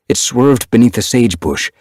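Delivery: usual speaking voice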